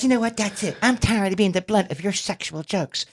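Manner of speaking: high-pitched mock female complaint; exasperated girlfriend voice, imitated; imitated fed-up girlfriend. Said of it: "she says".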